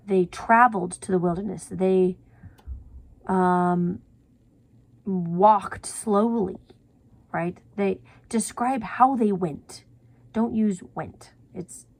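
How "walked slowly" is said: slow. Shown as a demonstration. Demonstrating a descriptive verb.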